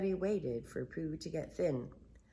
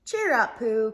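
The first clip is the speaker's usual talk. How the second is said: put-on cheerful boy voice; 6 semitones higher, 7 dB louder than usual. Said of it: "Christopher Robin said".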